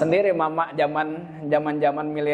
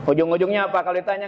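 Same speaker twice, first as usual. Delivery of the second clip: ordinary talk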